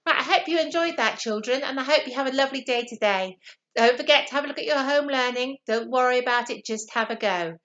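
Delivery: fast, bright goodbye to the class; teacher sign-off. Real, not acted.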